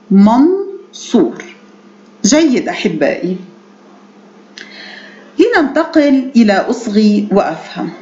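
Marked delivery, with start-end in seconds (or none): 0.1-1.4 منصور slowly in two parts, man-soor; slow model pronunciation. Shown as a demonstration. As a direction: model منصور at half pace, long "soo". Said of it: "المد الطويل الواو".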